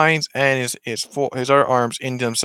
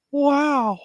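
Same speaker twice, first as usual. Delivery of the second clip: very high, drawn-out wonder; little girl's high amazed coo; Lilo. Acted. Little girl marvels at her new dog, high-pitched. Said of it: "she's like".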